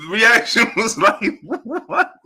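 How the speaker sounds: slow, high words broken up by laughter; laughing to tears. Real, not acted. Recalling his initial reaction to Tank's answer, laughing to tears. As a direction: laugh helplessly through the words. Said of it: "I'm literally in tears".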